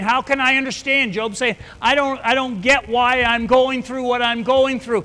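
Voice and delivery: high, loud anguished outcry; Job crying out. Acted. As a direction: cry out in bewildered anguish.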